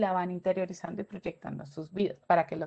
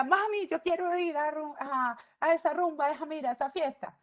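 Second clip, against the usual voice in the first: higher, whiny begging to mom; a kid begging to go out; child pleading; 6 semitones higher than usual. Retold or imitated. A teen begs his mother to let him go to the party, whiny and high.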